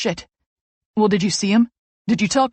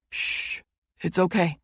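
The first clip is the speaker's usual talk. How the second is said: soothing hush; 3 semitones lower, 6 dB quieter, slower than usual. A narrator's reading. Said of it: "Jake soothes".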